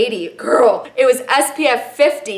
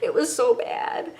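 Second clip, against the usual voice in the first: whispered, remembering the sting; pained whisper; 4 semitones higher, 8 dB quieter than usual. Retold or imitated. Recalling her sunburned butt cheek to viewers, whispered. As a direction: breathe it out in a whisper, wincing.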